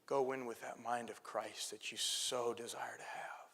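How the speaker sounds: soft, hushed encouragement; tender whisper. Retold or imitated. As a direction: comfort him gently, almost whispering. Tender counsel to weeping pastor.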